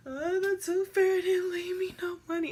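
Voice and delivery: put-on high, whiny child complaint; hammed-up kid upset. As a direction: wail it to mom. Crying to mom.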